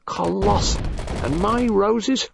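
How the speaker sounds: rising, eager boast; enthusiastic. A narrator's reading. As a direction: brag about the marrows, high and keen. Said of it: "said Spence with enthusiasm".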